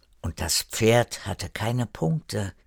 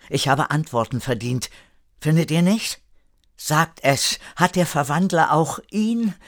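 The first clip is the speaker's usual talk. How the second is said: higher, agitated questioning; insistent demand; 6 semitones higher than usual. A narrator's reading. Erik presses his friends about his father, insistent. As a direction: press for answers, pitch climbing on each question.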